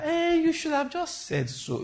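high, slow, put-on voice; a sly prophet, lightly voiced; mock prophet voice. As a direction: mimic the prophet sweetly. Mocking the prophet's reply.

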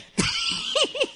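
high squealing burst of laughter. Real, not acted. Pastor laughing at his own curse story, high squeal. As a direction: break into a high, delighted laugh.

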